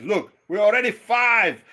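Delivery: high, loud, slow punchline; father's loud comeback to the preacher; loud retort. Retold or imitated. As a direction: land the joke big and slow.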